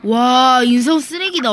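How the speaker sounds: shouting in disbelief; loud outrage. Real, not acted. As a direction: yell at a rude player. Outraged at rude opponent in game.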